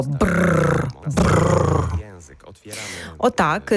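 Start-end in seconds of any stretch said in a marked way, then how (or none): 0.0-1.8 voiced lip trill, lips flapping. Shown as a demonstration. Voice coach demonstrates lip trill warm-up, buzzing. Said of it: "а є ще дзвінко".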